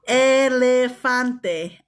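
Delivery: Elefante drawn out slowly, every syllable clear; slow careful repeat. Shown as a demonstration. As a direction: spell out Elefante slowly for the kids. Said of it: "slow repeat for learners".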